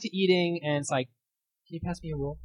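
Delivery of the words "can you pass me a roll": soft, meek little ask; sudden calm as if nothing happened; quiet dinner request. Retold or imitated. Family back to eating after the fight, quiet polite ask.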